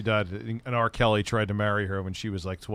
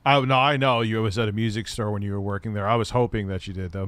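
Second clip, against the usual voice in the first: normal talking voice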